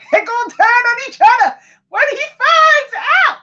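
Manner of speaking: high, loud excited American accent. Real, not acted. Tarot reader foreseeing them turning on each other, excited American accent. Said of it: "bursting into American accent".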